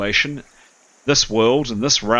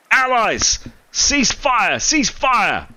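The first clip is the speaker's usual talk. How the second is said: Gildar yelling; 11 semitones higher, much slower than usual. Acted. Archer yelling at the tower to stop firing, frantic high yell. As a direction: shout them down to stop firing. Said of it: "he is screaming at the top of his voice".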